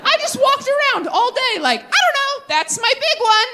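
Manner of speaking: high, breezy, clueless; clueless kid voice. Retold or imitated. Comic voicing her younger self, high and clueless. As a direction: float it up like an oblivious kid.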